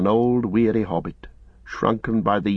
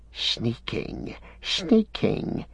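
hissed; 2 semitones higher, 6 dB quieter, much slower than usual. A narrator's reading. Gollum stung by Sam's insult, hissing. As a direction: spit the word sneaking like a hiss. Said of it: "he hissed".

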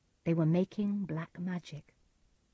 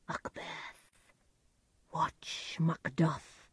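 much softer, slow eerie whisper; ghostly whisper; 5 dB quieter, much slower than usual. A narrator's reading. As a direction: breathe a warning from beyond. Soldier head ghost warns.

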